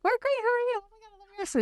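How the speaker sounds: very high-pitched, mock gushing greeting; gushing strangers. Retelling gushing, overly friendly strangers.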